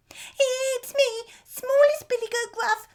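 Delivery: very high, loud squeaky little goat; terrified squeak. A narrator's reading. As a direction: squeak it out like a frightened kid goat. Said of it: "he replied in a terrified squeak".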